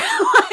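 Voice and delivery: sudden high cackle; high burst of laughter. Real, not acted. Laughing at her own Emma impression, high cackle. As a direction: crack up loudly.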